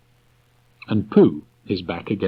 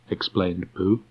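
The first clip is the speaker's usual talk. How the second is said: plain reading voice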